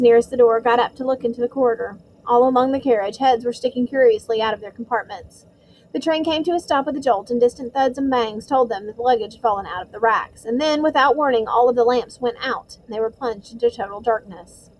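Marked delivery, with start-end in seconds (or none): none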